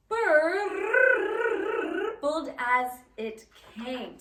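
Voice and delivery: teacher reads the crazy words to choir, slow dramatic recitation; slow, measured recitation with a rolled R; poem reading. Shown as a demonstration. Teacher showing choir the words, drawn-out poem reading.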